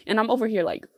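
ordinary talk, nothing marked

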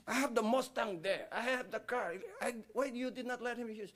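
high-pitched retold shouting match; shouting argument. Son and dad fighting over the Mustang, mock shouted protest. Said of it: "nagsisigawan sila".

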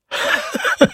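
short chuckling burst of laughter; character laughter. A narrator's reading. Godfather laughs off mother's question, chuckling. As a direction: laugh it off before answering. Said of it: "erwiderte Drosselmeier lachend".

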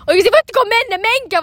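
high, loud, exasperated yell; imitated shouting. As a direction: re-enact yelling at people in the road. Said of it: "mä huuan ihan täysin".

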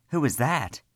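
put-on high, startled cry of a frightened boy; a young voice jumping up in alarm; panicked and excited. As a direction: blurt it out, panicked but thrilled. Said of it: "The young voice became panicked and excited".

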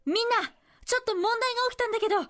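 high, projected dubbed-movie call; shouted announcement. Acted. Cinema staffer atop the counter to waiting customers, calling out. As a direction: call out to a crowded lobby in a hammed-up dub voice. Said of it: "と大声で叫んだのです".

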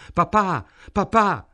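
high, loud cries for a father; distressed calling. A narrator's reading. Helen kneeling by her gassed father, crying out to him. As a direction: call out urgently, pitched well up.

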